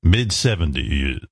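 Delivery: smooth DJ echo. Retold or imitated. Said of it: "that smooth delivery and that voice".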